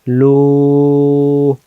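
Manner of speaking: লু with the vowel held long; one-alif elongation. Shown as a demonstration. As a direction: stretch the u of লু steadily. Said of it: "এক আলিফ".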